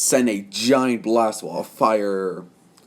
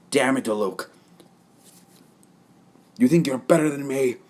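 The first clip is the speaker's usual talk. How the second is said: pissed off; 4 semitones higher than usual. Acted. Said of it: "Being, well, now pissed off".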